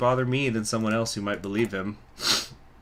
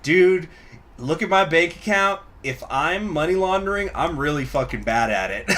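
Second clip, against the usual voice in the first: 1 mock exasperation; 5 semitones higher, 3 dB louder than usual. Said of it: "And it was like".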